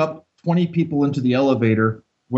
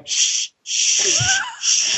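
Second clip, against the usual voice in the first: imitated group shushing; much slower than usual. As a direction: shush a whole elevator. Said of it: "We're all going".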